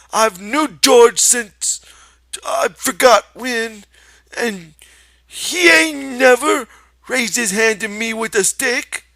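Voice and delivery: high, loud, slow insistence; belligerent. Acted. Lenny insists to the rabbit, loud belligerent. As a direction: defend him with stubborn heat. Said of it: "retorted belligerently".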